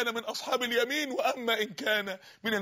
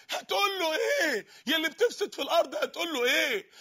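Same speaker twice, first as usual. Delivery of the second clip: impassioned challenge; 5 semitones higher than usual. Real, not acted. Preacher asks sinners what they will tell God, impassioned challenge. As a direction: confront the sinners with a wailing, insistent question.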